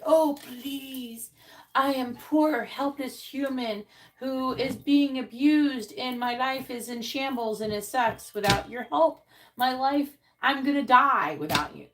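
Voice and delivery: over-the-top self-pitying plea; whiny mock plea. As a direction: whine it out like a pitiful victim. Mocking people begging for readings.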